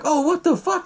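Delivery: high-pitched mock alarm. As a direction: act out a startled realization. Kid alarmed on seeing the killer.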